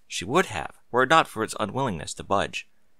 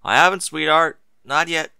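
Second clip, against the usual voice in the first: calling back; 6 semitones higher, 6 dB louder than usual. A narrator's reading. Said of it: "he called back to her".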